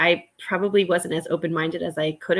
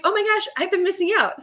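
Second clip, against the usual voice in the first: excited; 11 semitones higher than usual. Retold or imitated. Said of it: "I was like".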